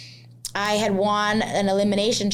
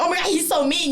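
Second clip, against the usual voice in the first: higher, mock whiny complaint; mock whining contestant; 9 semitones higher, faster than usual. Mocking the crying contestants, whiny mock voice. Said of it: "crying, like".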